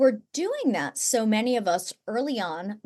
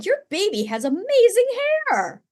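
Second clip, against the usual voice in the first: much higher, louder, delighted praise; 12 semitones higher, 5 dB louder, faster than usual. Acted. Proud parent hearing praise for her baby, high and delighted. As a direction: beam with pride at the compliment. Voicing joyful parent exchange.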